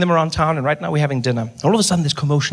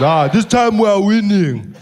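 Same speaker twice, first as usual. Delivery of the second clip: slow, louder, drawn-out voice; Malema impression; 5 semitones higher, 4 dB louder, slower than usual. Malema chatting with the comic, slow louder voice. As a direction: lean back and drawl it. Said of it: "He says".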